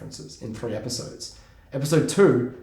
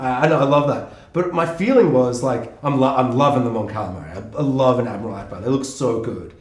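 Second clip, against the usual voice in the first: usual speaking voice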